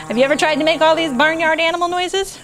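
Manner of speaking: plain reading voice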